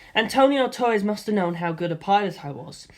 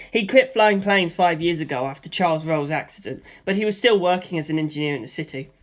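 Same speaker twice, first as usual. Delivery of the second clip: plain reading voice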